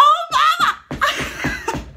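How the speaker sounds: long, high, laughing cry of delight. Real, not acted. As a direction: stretch it out, thrilled.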